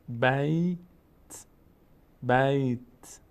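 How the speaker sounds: drawn-out بیت with a bare final t; final consonant vowelless. Shown as a demonstration. Teacher drills the stop, final consonant vowelless. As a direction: hold بیت, then close on t without a vowel. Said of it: "زیاد حرکت نباید بدیم".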